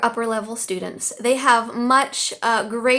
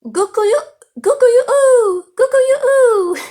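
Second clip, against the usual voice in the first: much higher and louder, a drawn-out crow; Chinese rooster crow; 13 semitones higher, 9 dB louder, much slower than usual. Shown as a demonstration. Teacher trying the Chinese rooster call, hammed-up and loud. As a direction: belt the rooster call like a farmyard bird. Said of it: "Did I say that right?".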